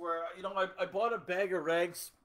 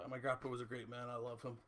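quiet, fast, holding back tears; real choked-up tribute to grandpa; 4 semitones lower, 9 dB quieter, faster than usual. Real, not acted. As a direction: voice catching on real emotion. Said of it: "oh, I'm gonna get choked up here".

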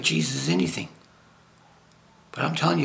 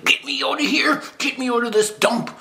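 higher, louder mock yell; ranting and raving; 11 semitones higher, 5 dB louder than usual. Imagining himself jailed with Paul and Silas, mock ranting. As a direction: shout it like a fed-up prisoner. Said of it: "I'd be ranting and raving".

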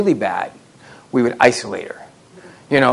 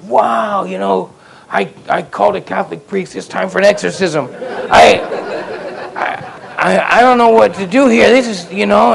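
mock alarm; 6 semitones higher, 5 dB louder than usual. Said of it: "and I'm like".

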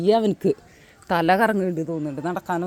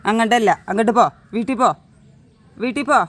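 raised voice, higher pitch; sharp ordering; 3 semitones higher, 4 dB louder, faster than usual. Real, not acted. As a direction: send a pet off home. Sending dog back to house. (വീട്ടിലേക്ക് ഓടിക്കുന്ന ആജ്ഞ)

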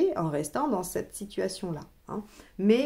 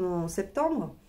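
ordinary talk, nothing marked

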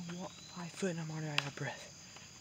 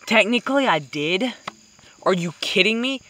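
loud, mock-outraged protest; outraged about a near miss; indignant disbelief; 4 semitones higher, 18 dB louder than usual. Real, not acted. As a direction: argue it out loud like you got robbed.

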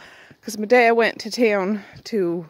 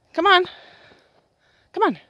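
calling the dog; 9 semitones higher, 3 dB louder than usual. Real, not acted.

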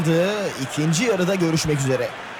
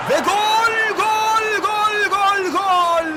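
goal scream, high and loud; screaming football commentator; 12 semitones higher, 3 dB louder, much slower than usual. Real, not acted. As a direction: yell the goal at full pitch. Goal scored, commentator erupts.

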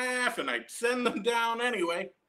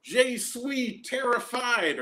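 high, slow whimpered plea; a child on the ride pleading with the operator, mock terrified; mock terrified kid; 7 semitones higher, much slower than usual. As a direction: fake a scared child begging off. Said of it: "yelling things like".

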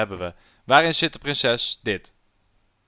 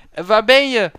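high, loud shout; searching call; 10 semitones higher, 11 dB louder than usual. Acted. Hero looking for princess, calling loudly. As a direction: call out across a distance.

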